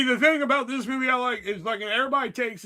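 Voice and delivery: ordinary delivery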